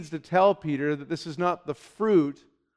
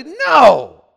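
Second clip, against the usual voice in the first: a loud, higher protesting NO; emphatic shout of no; 8 semitones higher, 11 dB louder than usual. Retold or imitated. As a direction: bark it out like a reformer objecting. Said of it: "And they said it like that".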